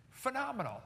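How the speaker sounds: phenomenal pitched high and stretched in wonder; awed emphasis. Real, not acted. Speaker marvelling to audience at tiny motor, awed.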